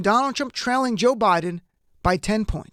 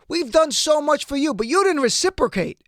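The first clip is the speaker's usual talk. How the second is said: higher-pitched mock pressure from a politician; Trump; 6 semitones higher than usual. Commentator imitating the president opening the call, mock Trump. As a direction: mimic the president leaning on someone, pitched up. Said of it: "it sounds like something from, like, Goodfellas or Donnie Brasco".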